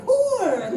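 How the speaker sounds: "horror" collapsed into a loud, high "hoor"; slurred one-syllable HORROR. Retold or imitated. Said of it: "it just sounded like I was straight up like".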